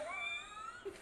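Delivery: very high squealing laugh; a high, soft shriek-laugh. Real, not acted. Runs from snake.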